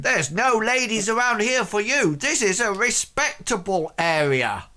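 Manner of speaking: high, emphatic Welsh rebuke; indignant Welsh accent. Acted. Blacksmith defending his respectable area, indignant Welsh accent.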